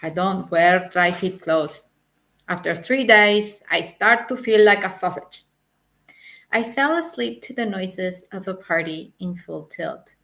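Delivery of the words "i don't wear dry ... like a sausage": put-on louder German-accented grumble. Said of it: "muttered in his German accent".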